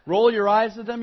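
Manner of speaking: normal talking voice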